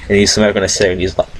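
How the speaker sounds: normal talking voice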